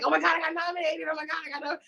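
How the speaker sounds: quick, high, breathless replay of her own excited gasp; retold excitement. Nominee reliving the moment she heard, excited. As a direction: rattle it off high and fast like the news just hit. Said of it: "And I was like".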